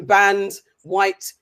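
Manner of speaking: ordinary talk, nothing marked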